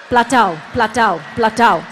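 low, punchy slap noises, platow; slap sound effect. Shown as a demonstration. Demonstrating slapping the water to wake it up, punchy slap noises. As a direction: smack out each platow like a hand hitting water. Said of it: "slap the water three times".

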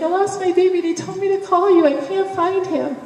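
retold frantic plea, high and loud; acting out a desperate phone call; crazy lady. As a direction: plead like a distraught caller, pitched right up. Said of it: "I was this crazy lady".

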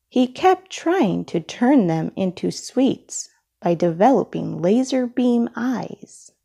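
ordinary delivery, nothing marked

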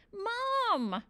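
high squawking call. A narrator's reading. Stuck boy calls his mother, squawking. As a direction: squawk for a parent, pitch way up. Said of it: "I squawked".

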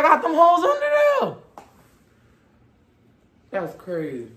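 muffled by fabric over mouth; speaking behind face covering; through a knit mask. Real, not acted. Seen 3: Shaggy knit balaclava covers mouth throughout; rolled up by 7.8.

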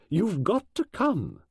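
slow, insistent and firm; firm insistence, drawn out. Acted. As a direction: leave no room for argument. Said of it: "She was quite firm about it".

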